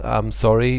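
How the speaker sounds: normal talking voice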